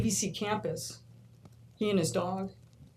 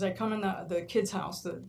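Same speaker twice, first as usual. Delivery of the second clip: usual speaking voice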